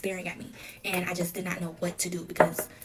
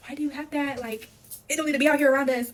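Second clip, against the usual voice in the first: granddaughter questioning boyfriend about the evidence, retold in higher-pitched alarm; 7 semitones higher, 6 dB louder than usual. Questioning boyfriend who brought the evidence back, retold alarmed protest. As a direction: imitate your own alarmed protest, pitched up.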